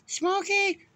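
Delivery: high-pitched calling yell; calling the dog. Retold or imitated. Brother-in-law calls the lost dog, high yell. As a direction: call out high to a hidden dog. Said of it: "He goes".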